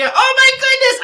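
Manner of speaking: high, delighted gasp of surprise; surprised. Real, not acted. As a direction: react amazed, pitch jumping up. Surprised at a matching comment.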